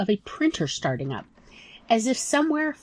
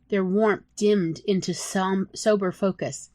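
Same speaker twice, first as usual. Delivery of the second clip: ordinary delivery, nothing marked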